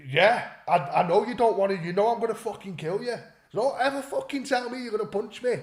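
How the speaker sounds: high-pitched acted warning; heated re-enactment of a threat; retold angry threat. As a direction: warn them off, pitch climbing. Retold threat back at opponent.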